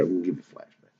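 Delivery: a low mysterious hush; hushed aside. Acted. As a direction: drop into a murmured aside. Announces flashback.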